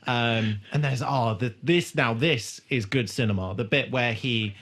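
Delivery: normal talking voice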